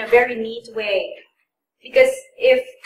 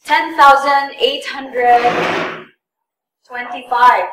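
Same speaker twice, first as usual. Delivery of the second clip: amount read very slowly and clearly; very slow, deliberate dictation; 3 dB louder, much slower than usual. Shown as a demonstration. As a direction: spell out the sum slowly.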